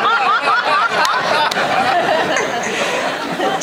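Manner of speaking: high, hearty burst of laughter; big laugh. Real, not acted. Laughing at his own story.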